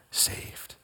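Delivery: hushed whisper. Acted. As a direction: drop to a whisper on the last word. Whispered end of scripture quote.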